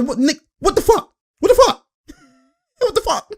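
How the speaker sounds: very high falsetto disbelief; mock bewildered player. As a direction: squeak it out as an imitation, breaking into laughter. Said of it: "He's like".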